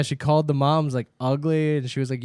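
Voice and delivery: usual speaking voice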